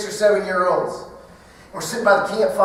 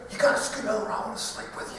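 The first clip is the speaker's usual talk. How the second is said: whisper; 2 semitones higher, 9 dB quieter, faster than usual. Retold or imitated. Son whispering at night.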